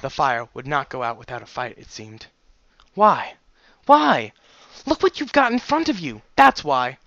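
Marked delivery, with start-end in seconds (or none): 2.9-7.0 high, loud, incredulous outburst; angry retort. Acted. Father arguing with his injured daughter, angry and high. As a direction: throw the question back angrily, pitch jumping up.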